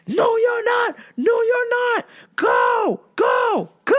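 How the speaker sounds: high, panicked shouted refusal; boss's mock yelling. Boss chasing the lady off, mock yelling. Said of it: "My mans was yelling".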